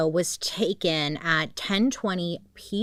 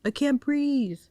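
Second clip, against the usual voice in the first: higher, slow, strained plea; struggling for air; 8 semitones higher, slower than usual. Retold or imitated. Trapped man begging his girlfriend, high and slow. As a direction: gasp it out like you're suffocating. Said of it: "A couple of times he says".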